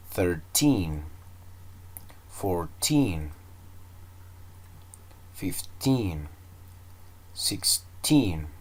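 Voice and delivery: put-on "Thirteen", "fourteen", "nineteen" each said slowly with the weight on the last syllable, "teen"; slow modelling count, punching "teen" in each word; stress on teen. Shown as a demonstration. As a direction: lean on the "teen" of every number, like a teacher modelling. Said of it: "estaba ocupando el acento en la última sílaba".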